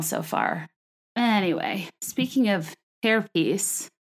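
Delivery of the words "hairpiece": HAIRPIECE with a clear "hair" first; hairpiece, not herpes. Shown as a demonstration. Host correcting the word, hairpiece with clear "hair". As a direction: say hairpiece with air in the first syllable. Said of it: "not herpes".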